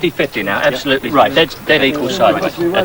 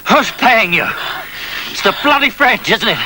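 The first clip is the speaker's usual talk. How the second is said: raised, scornful questioning; interrogating officer; 8 semitones higher than usual. Acted. Officer accusing the mercenary of working for the French, scornful. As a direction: sneer the accusation at him.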